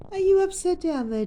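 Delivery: high loud amused question; surprised. Real, not acted. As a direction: exclaim at the upside-down bird. Surprised at bird upside down.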